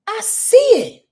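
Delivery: high, slow "I see it"; ecstatic cry. Pretend. Voicing the human at a breakthrough, in rapture. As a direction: call it out in rapture.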